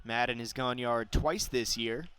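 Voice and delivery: usual speaking voice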